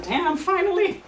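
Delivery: high-pitched gleeful relief; mock triumph. Therapist joking about getting paid to read, mock triumph. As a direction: let the pitch jump as if finally rewarded.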